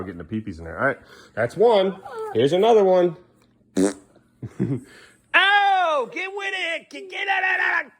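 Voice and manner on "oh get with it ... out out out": playful shooing. Real, not acted.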